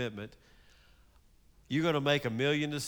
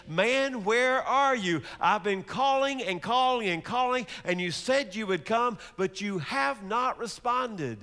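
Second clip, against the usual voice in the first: exasperated call; 6 semitones higher than usual. Acted. Traveler calls out for toll keeper.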